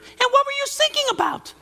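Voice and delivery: put-on very high squeaky kid voice with a laugh; child's voice. Son in the back seat teasing dad's hymn. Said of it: "from the back seat, I heard".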